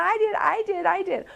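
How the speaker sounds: high, eager hand-raising mother. Retold or imitated. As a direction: bounce it up like an eager student. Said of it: "this mother raises her hand".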